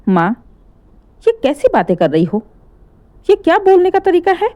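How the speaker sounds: son's indignant protest; angry son. Acted. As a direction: push back hard at mother's tone. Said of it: "गुस्से में विपिन भी बोल पड़ा".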